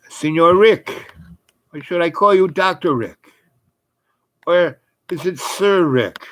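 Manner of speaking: put-on high, lilting German-accented greeting; put-on German accent, pitched well up. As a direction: imitate a German gentleman with a monocle. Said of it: "German accent".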